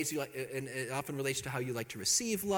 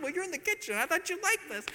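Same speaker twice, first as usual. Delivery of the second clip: self-excused thought; 14 semitones higher than usual. Retold or imitated.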